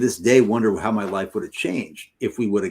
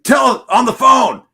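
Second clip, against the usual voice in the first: retold roommate's shout; 14 semitones higher, 8 dB louder than usual. Roommate calls his buddy to the phone, shouting. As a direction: imitate a roommate yelling out urgent news.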